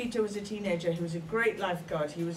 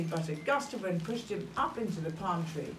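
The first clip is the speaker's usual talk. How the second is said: usual speaking voice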